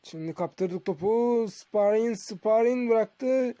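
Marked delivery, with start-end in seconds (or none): none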